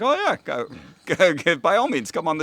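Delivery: high, laughing mock welcome; sarcastically eager, as if delighted to have them on; mock eager invitation. Skeptic host mockingly inviting a psychic guest, high and laughing. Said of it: "take that bait and say".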